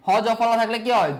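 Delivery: ordinary talk, nothing marked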